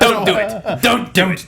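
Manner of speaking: lightly voiced Emperor pleading; Palpatine voice. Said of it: "for once he'd be like".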